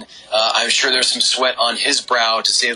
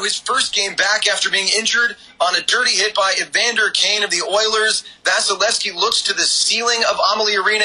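excited; 7 semitones higher than usual. Real, not acted. Stunned by overtime winner.

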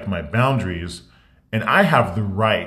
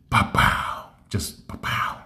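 whispered pow effect; 2 semitones lower, 4 dB quieter, slower than usual. Retold or imitated. Sound effect of thighs bursting.

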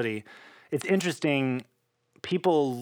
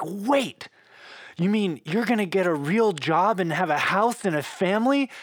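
high, louder astonished mother's voice; surprised mom; 7 semitones higher, 3 dB louder than usual. Retold or imitated. Mom in the car, stunned by son's news, high astonished voice. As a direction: mimic mom's stunned disbelief, pitched up.

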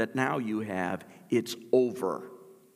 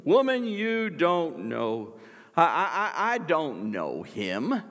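flustered denial; 4 semitones higher, 5 dB louder than usual. Acted. Peter denying to servant girl, stammering and rattled. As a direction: trip over the words in panic.

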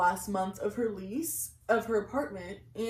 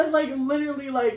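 usual speaking voice

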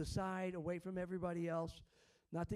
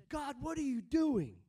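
high-pitched protest to God; exasperated questioning; 7 semitones higher than usual. Acted. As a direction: cry out in bewildered complaint. Acts listener questioning God's dealings.

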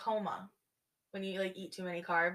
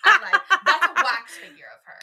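laughing; 7 semitones higher, 13 dB louder than usual. Real, not acted. Admits the joke, laughing.

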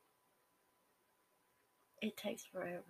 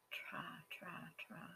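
unvoiced whisper, much softer, repeating it to yourself; a soft whispered mantra to yourself; whispered self-urging; 10 dB quieter, much slower than usual. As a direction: coax yourself under your breath. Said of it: "I tell myself all the time".

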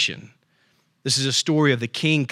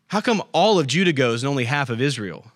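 ordinary talk

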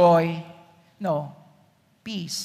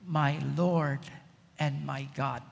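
much lower and softer, hushed; awed whisper of faith; 7 semitones lower, 9 dB quieter than usual. Acted. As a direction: breathe it out in awe. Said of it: "Thomas said these classic words".

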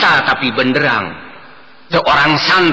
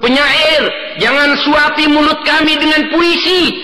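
hammy stage actor; 12 semitones higher than usual. Acted. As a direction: shout back at the poet. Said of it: "Orang-orang miskin menolak".

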